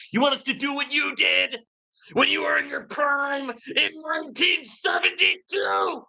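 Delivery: indignant shout. Real, not acted. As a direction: throw the question back at them, outraged. Rant at old-fashioned critics.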